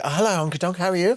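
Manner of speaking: high, camp comic greeting; camp character. Retold or imitated. Comedian imitates old sketch character greeting someone, camp and high.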